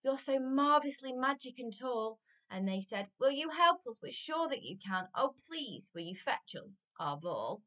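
lively, emphatic rhyming storytime voice; story reading to children. A narrator's reading. Adult reading a giant story to children, animated storytelling. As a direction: read it out with bouncing, punched words. Reads picture book to children.

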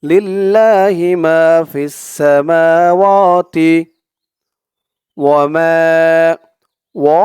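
ফিস সামাওয়াতি with each long vowel held one alif; slow melodic recitation; stretched vowels. Shown as a demonstration. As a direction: recite সামাওয়াতি slowly, drawing out মা and ওয়া. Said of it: "আমার সাথে বলুন".

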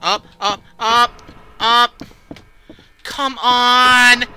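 frantic high shouts; being chased. Real, not acted. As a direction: yell urgently while fleeing. Running from the monster upstairs.

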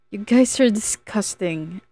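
ordinary delivery, nothing marked

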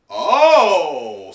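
loud, high startled oh; surprised oh. Real, not acted. Reader reacts to the page with a loud startled oh. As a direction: gasp out a surprised oh.

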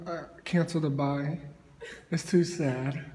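sad laugh. Real, not acted. Laughing off the sadness.

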